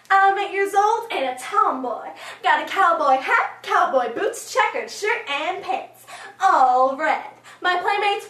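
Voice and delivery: put-on loud, boastful eight-year-old; excited tomboy. Eight-year-old showing off a cowboy outfit, excited. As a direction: rattle off the outfit with big, bouncy pride.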